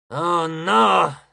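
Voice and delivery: loud, lamenting groan of protest; complaining. A narrator's reading. Titan left to guard the doors, complaining. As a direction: moan the words in dismay. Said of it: "si lamentò Crio".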